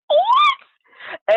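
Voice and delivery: very high, loud retold exclamation; loud squeaky shock; shocked disbelief. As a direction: replay astonishment with a squeal. Retelling shock at the news.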